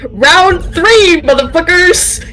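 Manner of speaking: high, loud battle cry; hyped shout. Real, not acted. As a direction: yell it to start the fight. Hyped for the next fight.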